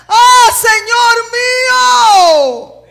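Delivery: long, high alarmed shout; very loud, drawn-out exclamation; exclaimed cry. Acted. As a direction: cry out to your master in alarm. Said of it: "El hombre pega un grito".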